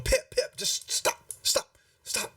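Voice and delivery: voiceless, urgent hushed voice; mother hushing her son. Acted. Mother tries to stop her little son, urgent whisper. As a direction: whisper sharply to make a child stop. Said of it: "she's like going".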